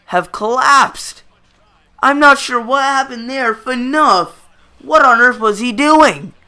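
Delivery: ordinary talk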